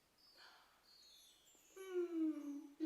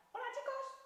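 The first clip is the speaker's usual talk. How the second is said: happy frog voice. Pretend.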